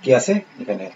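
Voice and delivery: ordinary delivery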